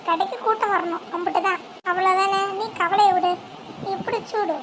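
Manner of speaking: ordinary talk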